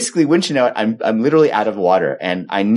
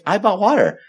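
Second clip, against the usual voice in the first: high-pitched, insistent repeat; insistent wife; 9 semitones higher than usual. Retold or imitated. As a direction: stand your ground in a higher voice. Wife insists in retold argument.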